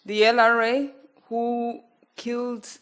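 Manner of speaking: ordinary talk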